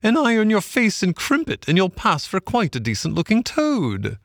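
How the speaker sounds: high, swooping mockery; called-out jeer. A narrator's reading. Barge woman mocking Toad on the bank, high, called out. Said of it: "she called out".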